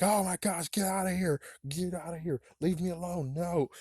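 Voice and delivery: high-pitched mock pleading; hammed-up high, fretful protest; panicked plea. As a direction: act out shooing off a tormentor. Acted plea to lurking Slappy.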